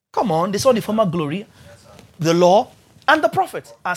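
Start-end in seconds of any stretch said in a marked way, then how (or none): none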